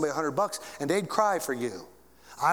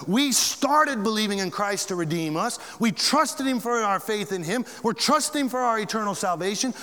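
higher, louder preaching climax; full-force preaching; 8 semitones higher, 4 dB louder than usual. Acted. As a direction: build the sermon to a ringing peak.

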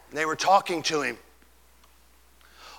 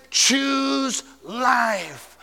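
long, weighted "Choose"; commanding plea; 6 semitones higher, 4 dB louder than usual. Acted. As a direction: urge it slowly. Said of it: "He said".

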